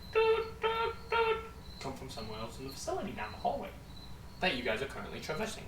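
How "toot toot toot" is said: answering beeper. Acted. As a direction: mimic an answering tone down the hall. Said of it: "as you hear".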